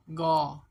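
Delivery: गौ loud and long as open "gaw"; syllable sound demo. Shown as a demonstration. Teacher answers what the letters make, loud drawn-out गौ. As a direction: stretch गौ firmly as one syllable. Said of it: "ये क्या बनेंगे?".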